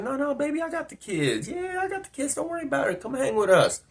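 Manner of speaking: high, soft, insistent reassurance; imitated coaxing woman. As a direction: wave off his excuse in a high voice.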